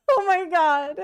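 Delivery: high, laughing gasp of disbelief; laughing oh my god. Retold or imitated. Passengers stunned by Frosty's quip, laughing. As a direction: squeeze it out through a laugh. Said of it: "where we're like".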